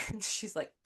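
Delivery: normal talking voice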